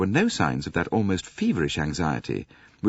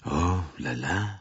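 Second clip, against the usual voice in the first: vexed; 4 dB quieter, slower than usual. Acted. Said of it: "said Poirot vexedly".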